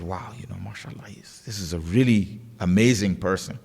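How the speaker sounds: softly awed, low-pitched retelling; impressed. Retold or imitated. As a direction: drop low and hushed in mild awe. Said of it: "he was so impressed with him".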